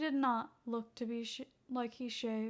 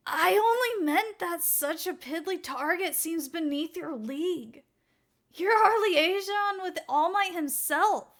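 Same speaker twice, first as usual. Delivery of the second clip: taken aback; 6 semitones higher, 8 dB louder than usual. Acted. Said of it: "His comrade recoiled, shocked".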